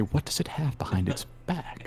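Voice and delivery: hushed curious whisper. Acted. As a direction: whisper it, leaning in. Character whispers question.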